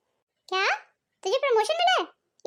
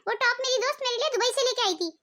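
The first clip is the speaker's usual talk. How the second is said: ordinary talk, nothing marked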